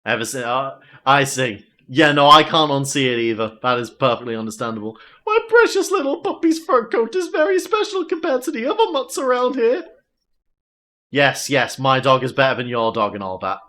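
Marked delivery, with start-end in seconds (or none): 5.2-9.8 much higher, prissy boasting; a haughty owner bragging about her dog; snobbish dog lady. Acted.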